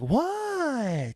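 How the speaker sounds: put-on high, drawn-out child's "what"; mock kid outrage; indignant kid. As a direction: squeal it like a defiant little girl. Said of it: "she's like".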